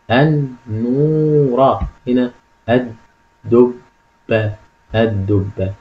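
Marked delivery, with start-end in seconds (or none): 0.1-1.8 النورة very slow, middle vowel held long; syllable-by-syllable reading. Shown as a demonstration. Teacher reads out the analysed word, slow syllable by syllable. 2.7-4.5 الدبة split into separate syllables, pausing between each; sounding out parts. Shown as a demonstration. Teacher reads out the analysed word, slow separated syllables. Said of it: "لاحظ هنا يا أستاذ الدبة".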